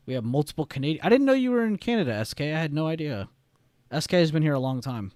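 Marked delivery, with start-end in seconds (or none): 1.0-3.3 sudden high, fast burst of surprise; real delighted surprise, high and quick; surprised. Real, not acted. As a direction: caught off guard, blurt it out. Learning chatter is in Canada.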